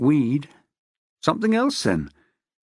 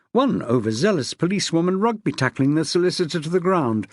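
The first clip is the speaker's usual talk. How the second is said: usual speaking voice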